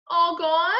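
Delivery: put-on high, small child's question; little toddler. A narrator's reading. Hurt little brother asking about his teeth, childlike. Fudge asked again.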